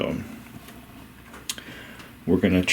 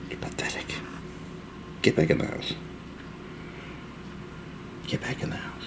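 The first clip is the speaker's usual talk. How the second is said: whispered mock scolding; teasing whisper; 12 dB quieter, faster than usual. Real, not acted. Owner whispering teasingly to his cold cat.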